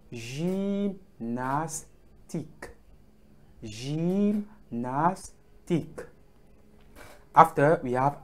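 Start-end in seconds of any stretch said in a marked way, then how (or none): 0.1-5.3 gymnastique in slow, separated syllables, "zheem-nas-teek"; French gymnastique. Shown as a demonstration. Teacher modelling the word, gymnastique syllable by syllable. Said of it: "the word".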